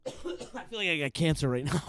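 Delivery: coughing fit mid-sentence. Real, not acted. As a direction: talk through a coughing fit. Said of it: "Can't stop coughing".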